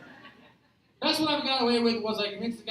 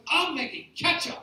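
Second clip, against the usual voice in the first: higher-pitched, proud announcement; beaming pride; 5 semitones higher than usual. Acted. Duped worker tells his manager, beaming pride. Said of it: "just a smile full of pride".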